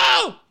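high-pitched excited yelp; sudden scream. Real, not acted. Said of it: "Are you gonna scream".

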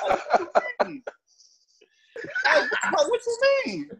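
high, laughing "what you mean"; laughing disbelief. Real, not acted. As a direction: laugh through the question in disbelief.

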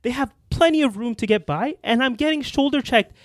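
indignant, pitched well up; big mad. Real, not acted. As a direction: complain in disbelief at being bumped. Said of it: "this is how you know [name] was big mad".

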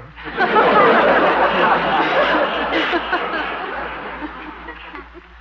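high, long tickled giggle. Real, not acted. [name] laughing at his joke to the statue, high giggle.

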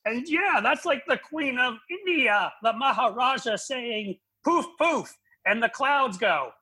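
a high, drawn-out send-up of a rambling comic; mocking Dennis Miller. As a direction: pitch it way up and drag it out to lampoon him. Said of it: "Like Dennis Miller, ... he's like".

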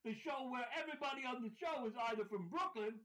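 hammed-up show host. Cohost opening the show in a hammed-up host voice. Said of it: "welcome to Spinny Spinny Dum Dums".

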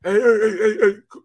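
high, loud calls for a sub, then fading; mock hurt player calling. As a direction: wave in a replacement after a big hit.

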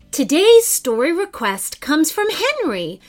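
usual speaking voice

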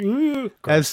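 high, loud disgusted squeal; grossed out. Retold or imitated. Podcaster mimicking a teen finding old fries, disgusted squeal. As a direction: recoil at the gross snack. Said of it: "things that are like".

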